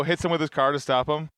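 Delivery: ordinary delivery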